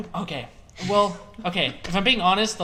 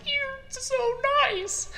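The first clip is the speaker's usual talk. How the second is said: high gushing thanks; much slower than usual. Retold or imitated. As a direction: gush at a friend like a sweet kid. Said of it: "I was like".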